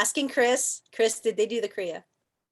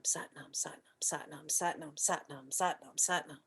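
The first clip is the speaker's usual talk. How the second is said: whispered mantra; 4 semitones lower, 7 dB quieter than usual. Shown as a demonstration. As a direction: whisper Satnam in a steady rhythm. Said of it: "everything you're doing has Satnam".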